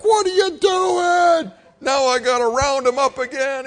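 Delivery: hammed-up dad yelling; imitating dad shouting. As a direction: shout like a furious farmer father. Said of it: "my dad's like".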